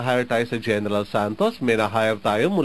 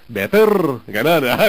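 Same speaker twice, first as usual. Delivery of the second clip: Ilocano accent; 5 dB louder than usual. Shown as a demonstration. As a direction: put on an exaggerated Ilocano accent for "better".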